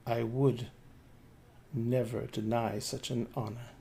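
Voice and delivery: a touch of chill, quiet and distant; understated cold acceptance of a dance. Acted. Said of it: "In that same cold voice".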